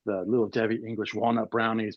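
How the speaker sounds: usual speaking voice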